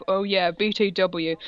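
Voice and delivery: normal talking voice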